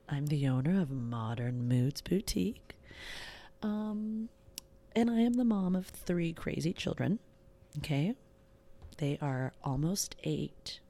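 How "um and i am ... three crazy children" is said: normal talking voice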